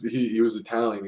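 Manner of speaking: usual speaking voice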